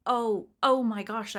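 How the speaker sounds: loud, drawn-out mock dismay; shocked realization. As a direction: let the "oh" burst out slowly. Acted shock at learning of hit.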